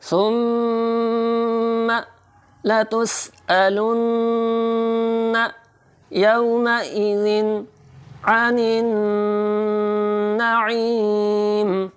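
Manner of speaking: high slow chant, long ई; तजवीद से तिलावत. Shown as a demonstration. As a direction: sing it, stretching नईम. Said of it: "ई को थोड़ा सा खींच के पढ़ेंगे". (नईम की ई खींच कर तिलावत)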